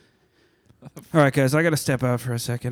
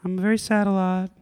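sad masked oddball woman; 8 semitones higher than usual. Acted. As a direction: confess it as an awkward, mournful guest. Plays Stephanie, a sad guest.

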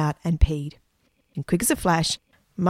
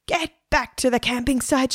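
urgent higher-pitched call, no real volume; mild shout; 6 semitones higher than usual. A narrator's reading. Margaret urging the others back to camp, mild shout. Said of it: "shouted Margaret".

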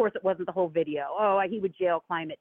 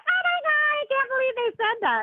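much higher, hammed-up shocked gasp of a voice; mocking shocked fans. Imitating offended DeSantis fans, very high mock outrage. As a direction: mimic outraged fans in a shrieking whine. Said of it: "everyone's like".

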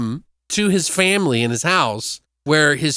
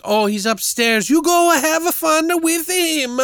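high, hammed-up mock Swedish; exaggerated Swedish sing-song impression; bizarre Swedish accent. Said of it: "with this bizarre fucking accent".

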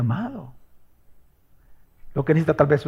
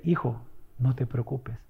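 put-on soft, low reassurance to a child; calm the child down gently; reassuring parent; 7 semitones lower, 11 dB quieter than usual. (padre que consuela al hijo, con voz suave y baja)

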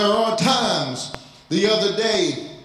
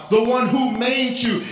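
normal talking voice

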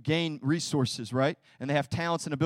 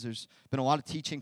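ordinary delivery

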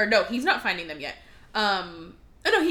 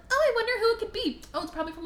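mock Belle; 11 semitones higher, much faster than usual. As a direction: wonder aloud in a high put-on voice. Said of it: "I'm gonna do that again".

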